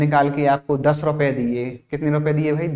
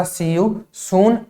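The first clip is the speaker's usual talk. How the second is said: see you soon, each word stretched out; slow model phrase; 4 semitones higher, much slower than usual. Shown as a demonstration. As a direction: spell it out slowly for the class. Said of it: "जल्दी मिलेंगे का English translation क्या होगा". (धीरे-धीरे खींचकर बोलें)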